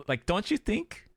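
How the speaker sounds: usual speaking voice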